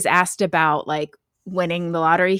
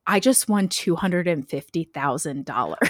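retold win, low-key and softly delivered; stunned understatement; 3 semitones lower, 4 dB quieter than usual. Lottery winner turning to the cameraman, stunned understatement. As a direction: play down the big news in disbelief.

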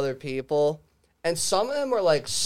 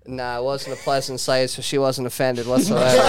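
normal talking voice

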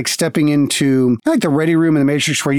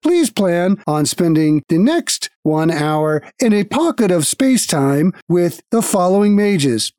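guild automaton; 5 semitones higher than usual. Acted. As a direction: speak like a polite machine at a desk.

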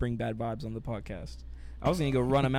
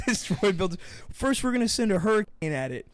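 loud, higher hammed-up host voice; game show host; 7 semitones higher, 7 dB louder than usual. Pretending to host a game show, hammed-up and loud. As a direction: sell it like a cheesy TV host.

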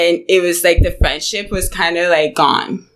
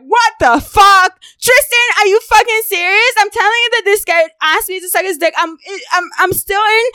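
heated outburst; 11 semitones higher than usual. Retold or imitated. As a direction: blow up in disbelief at him. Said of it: "you know, I'm heated".

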